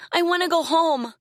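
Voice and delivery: put-on high, loud tearful girl's plea; crying plea. A narrator's reading. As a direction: beg through tears. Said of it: "she cried".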